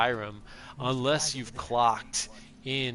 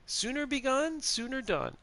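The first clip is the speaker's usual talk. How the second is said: higher, lightly voiced woman's line; quoting game character; 11 semitones higher, 3 dB quieter than usual. Streamer repeating the quiz quote for viewers, lightly put-on woman's voice. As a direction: repeat her motto in a lifted voice. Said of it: "it is a woman".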